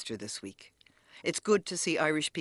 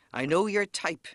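mild mock roar of an angry bus driver, a bit higher; retold roar; 5 semitones higher, 4 dB louder than usual. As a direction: play the furious driver, but only half-raised. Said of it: "he roared at my bewildered friend".

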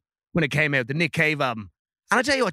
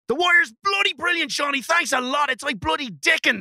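mock indignant outburst; 10 semitones higher than usual. [name] snapping at the panel in high mock outrage. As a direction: bellow it like an offended snob. [name] defending The Wire hotly.

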